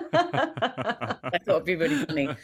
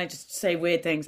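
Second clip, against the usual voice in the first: ordinary delivery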